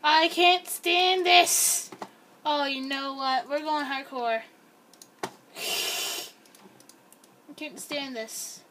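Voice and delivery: normal talking voice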